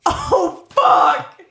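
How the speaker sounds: loud startled curse; shouting. Real, not acted. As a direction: blurt it out in alarm. Shocked at time left.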